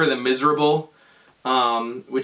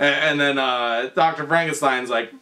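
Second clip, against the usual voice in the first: ordinary talk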